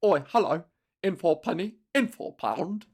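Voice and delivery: mock cockney with an oi; lightly voiced London geezer; hint of a cockney accent; British accent. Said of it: "That's still a British one".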